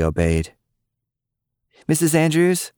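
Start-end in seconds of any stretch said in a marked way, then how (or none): 1.9-2.7 put-on high, rising girlish call. A narrator's reading. Cicely calling out to Lily's mom, high. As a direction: call into the kitchen eagerly. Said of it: "the energetic girl called out again".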